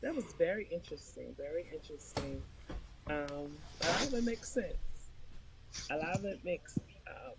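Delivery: distant, faint mic sound; speaking far from the mic; far away. Real, not acted. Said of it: "You sound far away".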